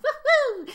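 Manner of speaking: loud, very high "woo-hoo"; high whoop. Pretend. Host cheers during her own theme tune, high whoop. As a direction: whoop like a one-woman crowd.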